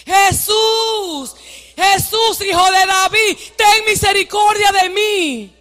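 high, drawn-out plea for mercy; crying out. Shown as a demonstration. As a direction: cry out to lead the congregation. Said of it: "empiecen a gritar".

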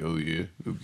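low, quiet, faraway mumble; Lou Diamond Phillips impression. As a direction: mumble it like a dazed actor. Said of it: "That's my Lou Diamond Phillips impression".

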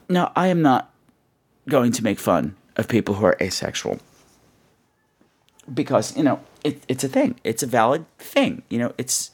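usual speaking voice

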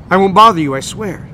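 booming loud voice; dopey cartoon bellow. A narrator's reading. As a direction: bellow it over traffic noise. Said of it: "he says in a voice louder than the traffic".

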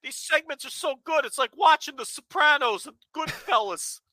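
high-pitched mocking fan voice; sarcastic imitation of an overexcited reviewer; mock gushing.

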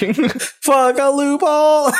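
high, laughing cry of dismay. Pretend. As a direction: squeal it in mock horror. Echoing the dismayed monster.

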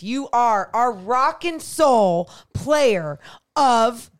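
slow, loud hyped announcer call. Acted. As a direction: draw it out like an arena announcer. Announcing the player of the game.

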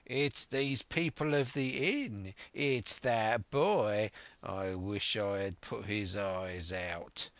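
slow, menacing blind villain; put-on villain voice, slowing into menace; bitter cry. A narrator's reading. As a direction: drag out the words with vengeful spite. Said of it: "cried the blind man Pew".

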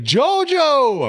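high, loud sing-song call of the nickname; high gleeful call of the name; family teasing. Retold or imitated. Whole family greets him mockingly.

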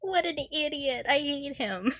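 ordinary delivery

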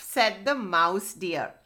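ordinary delivery, nothing marked

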